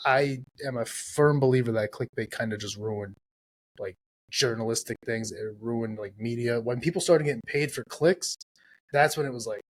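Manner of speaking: normal talking voice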